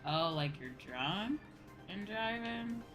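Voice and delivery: ordinary talk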